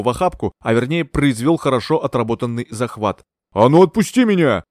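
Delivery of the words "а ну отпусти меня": louder angry roar. A narrator's reading. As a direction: bellow to be let go. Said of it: "взревел Бертрам". (рёв)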